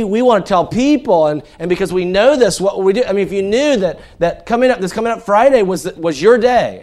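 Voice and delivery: loud, high preaching push; impassioned sermon delivery; fervent preaching. Acted. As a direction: drive the point home to the congregation. Preaching at full force.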